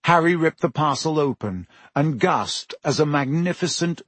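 usual speaking voice

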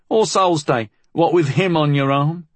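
high-pitched teasing voice; amused teasing girl. A narrator's reading. Molly ribs the housekeeper about Flood, teasing. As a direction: tease gleefully in a high voice. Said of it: "Molly laughed".

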